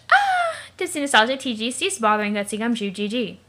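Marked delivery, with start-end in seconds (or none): 0.1-0.6 startled high shriek; a sudden fright at the witch; scream. Acted.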